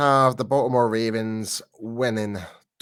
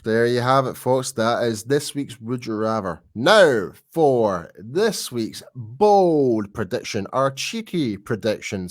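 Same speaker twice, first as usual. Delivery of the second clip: host segue; 4 dB louder, slower than usual. Acted. Host segment transition.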